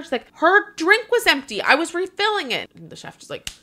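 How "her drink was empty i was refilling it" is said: defensive server. Retold or imitated. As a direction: squeak out the excuse. Server defending herself, retold.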